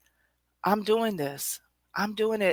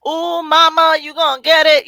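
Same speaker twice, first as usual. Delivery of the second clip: higher, louder sassy tease; loud playful singsong; louder sassy taunt; 10 semitones higher, 12 dB louder than usual. Pretend. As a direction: really lean into the taunt. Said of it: "That's me".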